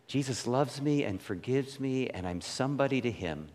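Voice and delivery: soft, low self-talk; inner voice. Retold or imitated. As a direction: quietly reassure yourself. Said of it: "and say".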